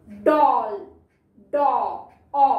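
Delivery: "डॉल", then "डॉ" and an open aw by itself; short O. Shown as a demonstration. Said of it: "short sound है".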